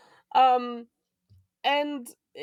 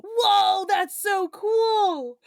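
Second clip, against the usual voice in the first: amazed; 7 semitones higher, much slower than usual. Retold or imitated. Steven amazed, voiced.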